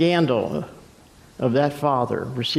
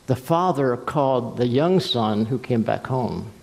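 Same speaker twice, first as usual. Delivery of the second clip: ordinary talk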